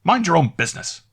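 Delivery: high, loud cutting-off snap; sharp rebuke. A narrator's reading. Landlady cutting off her maid, sharply. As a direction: shut the maid up at once. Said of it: "interrupted her mistress".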